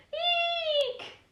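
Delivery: very high little squeak of fright; frightened squeak. Acted. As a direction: squeak like a scared knight. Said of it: "squeaked the knight".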